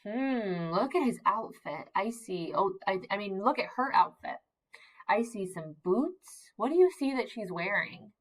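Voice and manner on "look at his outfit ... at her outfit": ordinary talk, nothing marked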